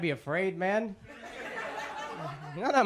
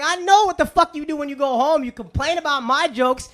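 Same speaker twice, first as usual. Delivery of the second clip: raised, higher-pitched angry accusation; mad rant; 6 semitones higher, 5 dB louder than usual. Retold or imitated. Comic to audience who complain about his jokes, mad. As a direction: call out the hypocrites, loud and worked up. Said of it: "I was getting so mad".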